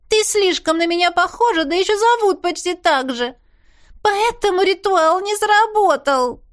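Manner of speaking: high, agitated yell. A narrator's reading. Cat yells at her lookalike about the failed ritual, high yell. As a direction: yell it in a high-pitched fit. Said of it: "проорала хвостатая".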